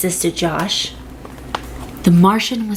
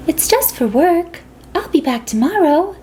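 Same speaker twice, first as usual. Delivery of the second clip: mom voice; 8 semitones higher than usual. A narrator's reading. Mom leaving for work.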